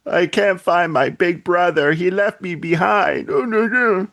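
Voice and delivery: put-on high, small crying child voice; little girl voice. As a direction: whimper it like a lost little girl. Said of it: "She's like".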